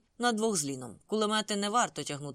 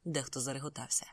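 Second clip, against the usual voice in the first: plain reading voice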